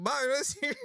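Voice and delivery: high-pitched mock ad announcer; teasing copy of a 1950s pitchman; imitated fifties advertising. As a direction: mimic an old-time commercial pitch, way up high. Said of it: "it's like advertising from".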